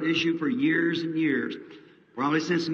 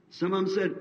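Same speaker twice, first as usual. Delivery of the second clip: usual speaking voice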